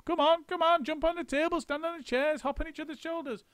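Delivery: very high, rushing frantic orders; a frantic monkey whipping up his crew; high frenzied monkey urging; frenzy of excitement. A narrator's reading. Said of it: "in a frenzy of excitement".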